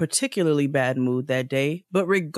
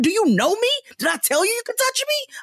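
furious retold yell; faster than usual. Yelling at the man who grabbed her arm, much higher furious yell. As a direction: tear into him for grabbing you.

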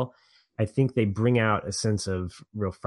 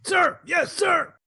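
shouted high and loud recruit reply; barked sir yes sir; 4 dB louder, slower than usual. Pretend. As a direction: sound off like a scared recruit. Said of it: "Much better".

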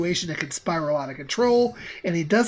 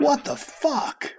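high, slow startled curse; shocked and baffled; 6 semitones higher, slower than usual. Acted. Chad startled by voice.

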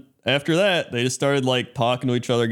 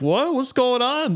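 high, puzzled mock confusion; playing dumb; 12 semitones higher, slower than usual. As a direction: sound lost and harmless on purpose. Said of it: "pretend like you're stupid".

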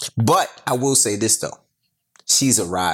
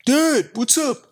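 high, slowed mock-son voice; imitated teenage son; 13 semitones higher, slower than usual. Father imitates woken tough son, high drawn-out voice. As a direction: play the woken son calling Dad. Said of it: "I wake him up".

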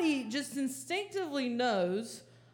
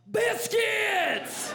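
much higher, loud desperate shout of the one word; yell; 10 semitones higher, 6 dB louder than usual. Retold or imitated. Hungry driver shouting an order into the speaker: loud yell. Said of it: "and I just yell".

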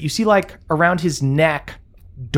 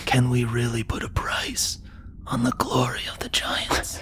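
soft unvoiced whisper from nowhere; eerie whisper; 6 dB quieter than usual. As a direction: whisper it like a voice in his head. Helm voice speaking in head.

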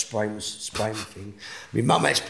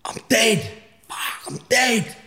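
high, loud panicked cry; sudden horror at being dead; realising he has died; shocked realisation; 13 semitones higher, 4 dB louder, much slower than usual. Retold or imitated. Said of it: "And I was like".

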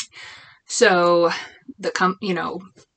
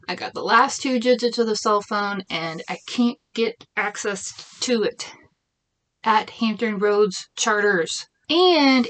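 normal talking voice

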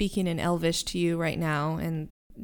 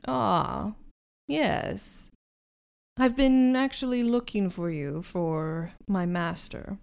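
haughty butler; slower than usual. Acted. GM voices haughty elf butler.

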